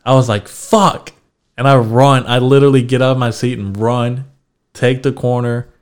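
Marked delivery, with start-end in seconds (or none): 0.5-1.1 sudden high-pitched panicked curse. Retold or imitated. Comic realizes his name was just called, panicked curse. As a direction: blurt it out the moment you realize you're up.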